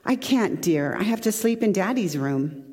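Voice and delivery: ordinary talk, nothing marked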